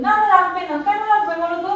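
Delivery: high, insistent protest; urgent pleading. Pretend. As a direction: plead with the caller to stay on. Urges caller not to hang up.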